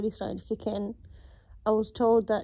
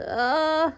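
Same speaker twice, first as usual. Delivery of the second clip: pained cry; 7 semitones higher, 4 dB louder than usual. Real, not acted. Hips hurting.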